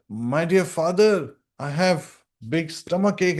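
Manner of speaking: high, slow mock cry of pain; imitated crying out. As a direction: act out a child wailing to father. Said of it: "he's crying out".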